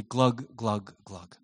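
comic sinking glugs fading away; drowning glug. Pretend. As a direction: gurgle it out as you go under. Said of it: "my last words will be".

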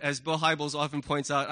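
usual speaking voice

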